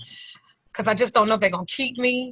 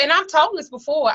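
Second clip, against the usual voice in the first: fighting back tears; 7 semitones higher than usual. Real, not acted. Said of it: "and I'm trying not to cry".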